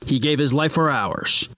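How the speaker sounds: low, grave caped-hero voice; deep superhero voice. Acted. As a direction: speak like a brooding crime fighter. Superhero talking to Robin.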